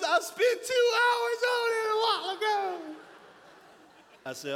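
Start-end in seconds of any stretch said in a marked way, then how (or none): 0.0-2.8 high, loud, wailing wife voice; hammed-up wail of a hurt wife; upset wife. Pastor imitating his upset wife's wail. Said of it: "She goes".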